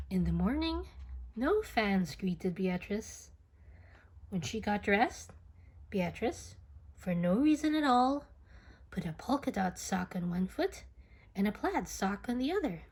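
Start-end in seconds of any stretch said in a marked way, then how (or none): none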